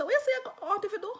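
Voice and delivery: high, quick questioning; taken aback. Retold or imitated. Man questions her big money request, taken aback.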